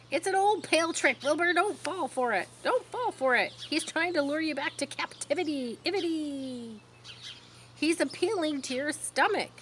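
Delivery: high, urgent goose voice; warning goose. A narrator's reading. Goose warning Wilbur of the trap, high. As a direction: warn him off the trick. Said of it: "said the goose".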